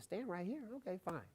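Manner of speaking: very soft questioning aside, then giving in; puzzled compliance. Retold or imitated. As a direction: query it under your breath. Acts out puzzled muttered compliance.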